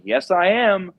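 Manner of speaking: punchy retold "reporting in" reply; eager reply. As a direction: answer up high and loud, eagerly. Acted eager answer to woman.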